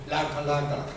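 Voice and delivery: low, emotionless syllables; sin emoción. Shown as a demonstration. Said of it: "algunas palabras también sin emoción". (sílabas dichas sin emoción)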